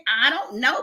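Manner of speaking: ordinary talk, nothing marked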